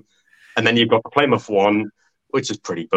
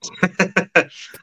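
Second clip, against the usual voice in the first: short burst of real laughter; laughing; 10 semitones higher than usual. Real, not acted. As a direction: laugh out, amused. Laughs at the joke scoreline.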